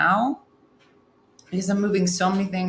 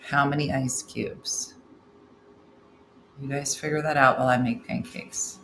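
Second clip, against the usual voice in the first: ordinary delivery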